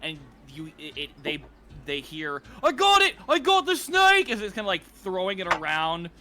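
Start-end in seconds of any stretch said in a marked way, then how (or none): 2.6-4.3 high, loud shout of triumph; guard shouting. Acted. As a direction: call out loudly to the other guards. Guard calls out amid the pile.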